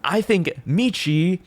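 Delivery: high, slow, smug provocation; mock drama bait. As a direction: play the troll stirring up drama on purpose. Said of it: "Like, say".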